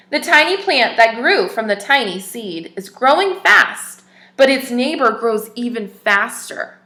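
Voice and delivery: clear, even storybook reading voice; story narration. Acted. Teacher reads a picture book to children, storybook narration. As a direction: read the tale aloud to little listeners.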